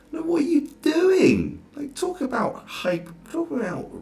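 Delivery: high, slow, drawn-out disbelief; high and slow, as if the writer lost their mind; incredulous. Real, not acted. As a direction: stretch it out, totally baffled. Ranting at irresponsible journalists.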